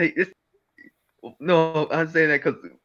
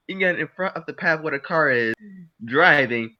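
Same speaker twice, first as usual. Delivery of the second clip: ordinary talk, nothing marked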